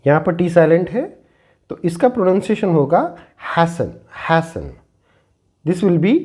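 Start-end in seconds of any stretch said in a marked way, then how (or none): 3.5-4.6 "hasten" said slowly as "haysen", T not sounded; slowly drawn out "hasten" with a silent T. Shown as a demonstration. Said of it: "when T is silent". ("hasten" में T silent, धीरे "हेसन")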